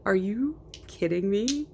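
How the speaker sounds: normal talking voice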